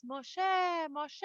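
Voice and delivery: soft, drawn-out call; mysterious calling voice. Retold or imitated. Voice from the bush calling Moshe, mysterious. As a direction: call the name softly from the bush. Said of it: "a voice says".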